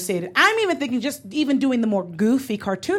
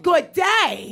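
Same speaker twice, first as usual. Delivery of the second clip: British good day; 7 semitones higher than usual. Shown as a demonstration. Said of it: "I mean, I said".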